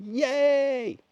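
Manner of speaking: high, bright mock cheer as the lights go on; sudden high burst of joy; excited cheer. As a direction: squeal it like fireworks going off. Everything lights up like fireworks.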